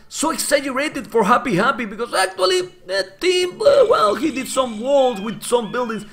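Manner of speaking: high-pitched frustrated complaining; angry. Real, not acted. Streamer complaining angrily about walls to chat. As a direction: vent your anger, voice pushed up high. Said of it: "I'm angry, man".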